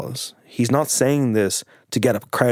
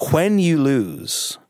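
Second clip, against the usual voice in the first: slow drawn-out tease; 6 semitones higher, much slower than usual. Retold or imitated. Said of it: "Jackson leaned over to him and he goes".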